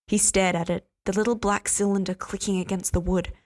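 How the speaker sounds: plain reading voice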